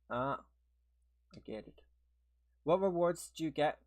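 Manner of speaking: ordinary talk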